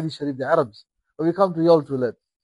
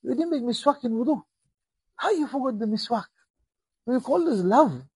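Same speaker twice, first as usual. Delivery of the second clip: reproachful; 6 semitones higher than usual. Acted. Prophet rebuking in a dream.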